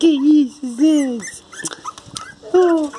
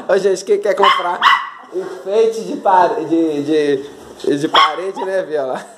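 much lower teasing voice; a lower, gruff joking voice; low mock voice; 10 semitones lower than usual. Real, not acted. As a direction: tease the dog in a deep put-on voice. Mocking question to dog.

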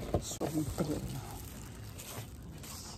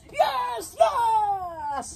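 touchdown call; 12 semitones higher than usual. Pretend. Celebrating a mock goal.

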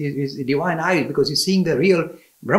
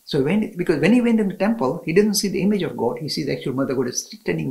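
usual speaking voice

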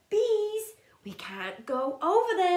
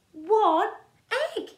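ordinary delivery

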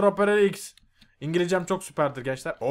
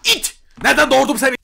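high, loud shrieking scold; angry mother shout; 10 dB louder than usual. Acted. Streamer voices furious mother scolding the mob, high shrieking yell.

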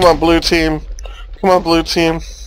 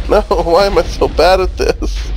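ordinary talk, nothing marked

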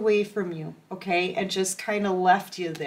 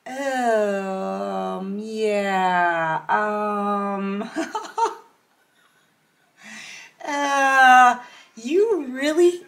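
hesitant, awkward mock reply with a laugh; awkward unimpressed reply; much slower than usual. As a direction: stall politely, clearly not into it. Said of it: "you're looking at this person like".

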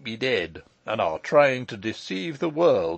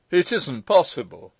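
put-on old uncle's louder, higher protest; crying out; 6 semitones higher, 7 dB louder than usual. A narrator's reading. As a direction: exclaim it in disbelief. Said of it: "cried Joseph".

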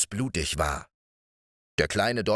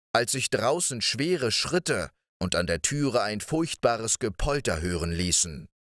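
usual speaking voice

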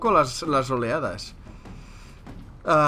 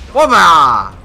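loud victory yell; triumphant shout; 3 semitones higher, 16 dB louder than usual. Real, not acted. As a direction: shout it like a winning hit. Triumphant kill in game.

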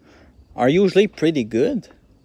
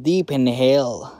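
speaking around a cigar; cigar in mouth; 3 semitones lower than usual. Real, not acted. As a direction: lips pressed on cigar. Seen 0: Cigar held at lips 0.6–1.1s, lowered at 1.3, back by 2.2s.

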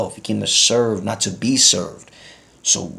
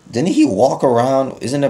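higher, louder challenge to the crowd; rhetorical question; 6 semitones higher, 6 dB louder than usual. Acted. Preacher challenging the congregation, higher and louder. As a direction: throw the question at the room.

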